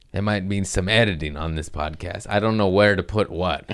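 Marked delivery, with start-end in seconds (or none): none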